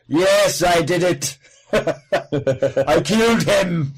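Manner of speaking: loud, booming slow Tom Baker voice; Tom Baker impression. Doing Tom Baker as Professor Plum, loud and booming. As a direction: boom it out like Tom Baker confessing. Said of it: "Tom Baker appeared as Professor Plum".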